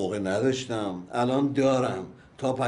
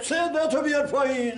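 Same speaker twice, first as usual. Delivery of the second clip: much higher and louder, cutting him off; sharp command; 13 semitones higher, 4 dB louder than usual. Acted. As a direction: bark it as a warning, high and loud. Telling him to lower voice. (فرمان تند، بلندتر و زیرتر)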